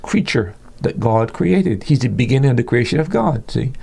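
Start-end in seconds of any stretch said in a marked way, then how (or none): none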